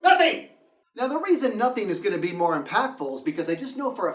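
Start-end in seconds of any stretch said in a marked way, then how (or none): 0.0-0.5 emphatic reveal. Real, not acted. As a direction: slam the punchline home. Punchline reveal.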